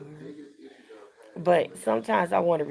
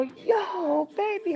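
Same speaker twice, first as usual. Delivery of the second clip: high mimicked hello; imitated excited greeting; 12 semitones higher, much slower than usual. Mother lighting up as daughter walks in, imitated excited greeting. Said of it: "she go".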